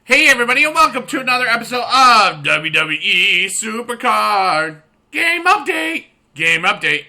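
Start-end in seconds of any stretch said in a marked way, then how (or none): 0.0-4.8 loud, high hype host shout; hype intro. Acted. 5.1-6.1 high, loud excited shout; excited exclamation. Acted. Exclaimed title.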